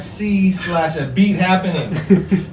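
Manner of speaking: laughing, slow and loud, spelling out the address; laughing plug. Real, not acted. Plugging their channel to listeners, laughing and loud. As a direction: drag out the web address through laughter.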